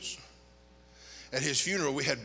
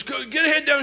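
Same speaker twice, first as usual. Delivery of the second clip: higher, louder urgent command; urgent order; 10 semitones higher, 6 dB louder than usual. Retold or imitated. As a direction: hurry the family inside. Urgent order under fire.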